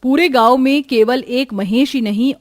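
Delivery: ordinary delivery, nothing marked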